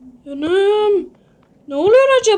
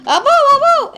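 ordinary talk, nothing marked

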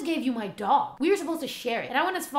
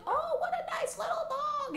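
put-on much higher, sweet old lady voice; 3 dB quieter than usual. Old lady admiring the little dog, high and sweet. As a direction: coo like a delighted old lady. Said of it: "this old lady came by and she was like".

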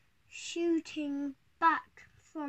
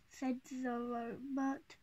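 usual speaking voice

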